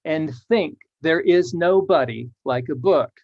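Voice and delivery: slow, measured poem reading; slow verse reading. Shown as a demonstration. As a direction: give each beat of the new line its weight. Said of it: "it became".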